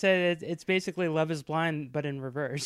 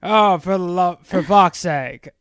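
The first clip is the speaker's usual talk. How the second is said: exasperated British actor voice, lightly done; sighing, fed-up aristocrat; Jeremy Irons impression; 7 dB louder, slower than usual. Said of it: "that's my Jeremy Irons".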